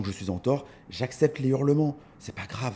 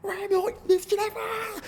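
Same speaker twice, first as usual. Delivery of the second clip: mock angry lady. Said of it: "the angry lady's reply".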